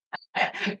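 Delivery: airy high giggle; breathless high giggle. Real, not acted. At the thought of channeling the KFC guy, a high airy giggle. Laughing at Colonel Sanders idea.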